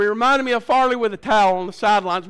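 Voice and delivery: ordinary talk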